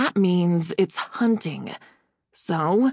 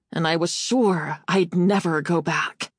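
usual speaking voice

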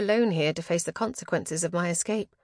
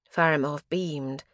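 plain reading voice

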